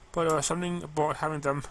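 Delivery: ordinary delivery, nothing marked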